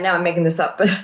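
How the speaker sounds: ordinary talk, nothing marked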